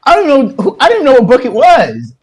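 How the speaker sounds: high-pitched, pleading self-defence; retold indignant protest. Boy telling girl he never touched book, retold indignation.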